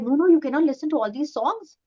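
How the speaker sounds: a hint of a mum laying down the rule; insistent mother. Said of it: "she's like".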